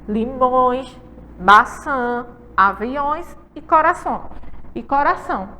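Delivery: "Limões", "maçã", "aviões", "coração" each with a full nasal vowel; careful classroom model of nasal words; nasal vowels stressed. Shown as a demonstration. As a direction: sound out each word, nasals humming in the nose. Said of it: "Tampem o nariz".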